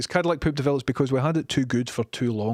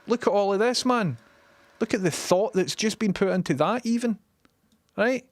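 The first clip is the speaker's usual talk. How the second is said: high mocking admiration, much higher; sarcastic praise; 6 semitones higher than usual. Real, not acted. Mocking the ugly buildings to viewers, sarcastic high praise. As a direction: gush with heavy irony.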